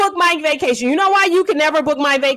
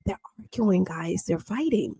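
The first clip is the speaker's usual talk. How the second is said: softer, leaning close to the phone; leaning in, hushed; 7 semitones lower, 9 dB quieter than usual. Retold or imitated. Retelling a friend's hushed report of a fight, softer. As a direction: hush it like a secret into the phone. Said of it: "she leans into the phone like this".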